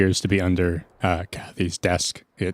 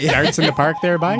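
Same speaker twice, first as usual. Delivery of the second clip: mock Canadian; 8 semitones higher, 5 dB louder than usual. Player teases Howie's Canadian talk.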